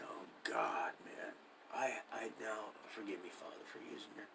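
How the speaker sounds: dropping to a whisper, asking God's pardon; murmured apology to God, voiceless; retold prayer-like whisper. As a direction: breathe it out under your breath, sheepish. Retold inner plea, dreading return.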